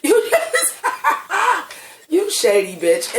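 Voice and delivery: high giggles breaking up the words; too tickled to get the scolding out; laughing mock scolding. Real, not acted. Fan calling Ryan Murphy shady, cracking up laughing.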